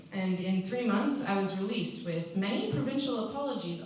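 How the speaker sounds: usual speaking voice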